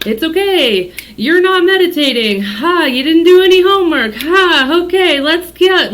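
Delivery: hammed-up cheery coach to lazy students; mock patient coach. Mimicking herself coaxing students who skipped homework, mock cheerful. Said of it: "being like".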